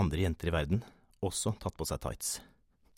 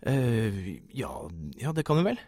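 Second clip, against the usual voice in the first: high, loud, hesitant yes; flustered agreement; 4 semitones higher, 4 dB louder than usual. A narrator's reading. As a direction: stumble into a caught-off-guard yes. Startled man stammers an answer. (forfjamset, nølende og høyt)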